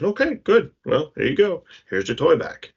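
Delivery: pleased dad. Retold or imitated. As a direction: hand it back with a bright approving lift. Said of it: "And he says".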